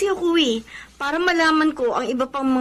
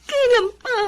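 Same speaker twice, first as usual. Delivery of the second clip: crying; 8 semitones higher than usual. Acted. Jobless friend breaks down crying.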